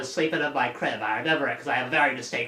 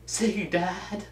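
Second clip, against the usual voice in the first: urging; 4 semitones higher, 3 dB quieter than usual. Real, not acted.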